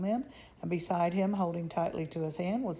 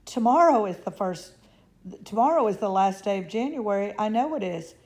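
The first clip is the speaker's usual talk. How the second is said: insistent; 3 semitones higher, 8 dB louder than usual. A narrator's reading. Mrs Bucket insists on date.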